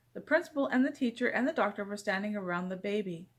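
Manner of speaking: usual speaking voice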